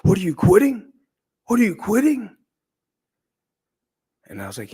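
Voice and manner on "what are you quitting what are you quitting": much higher, louder furious yelling; coach screaming. Retold or imitated. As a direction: scream it down at him like an enraged coach. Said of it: "he would be screaming at me".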